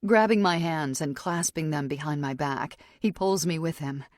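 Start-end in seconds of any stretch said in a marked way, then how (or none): none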